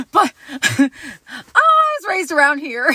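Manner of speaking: real laugh breaking into the words; amused laughing. Real, not acted. Answering a very loaded question on TikTok, amused laughing.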